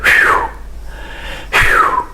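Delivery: a whistled breath out; relieved phew. Shown as a demonstration. Teacher demonstrating the relief sound, whistled phew. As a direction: blow out in relief. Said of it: "We just make the sound".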